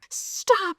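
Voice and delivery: distressed cry. A narrator's reading. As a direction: cry out high, pleading for it to end. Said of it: "she cried aloud".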